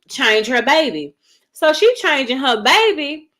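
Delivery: normal talking voice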